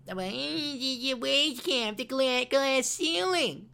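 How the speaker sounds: high, slow whining voice; mocking whine. Mimicking women whining about the wage gap, high and slow. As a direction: whine it like a complainer. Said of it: "whining and complaining".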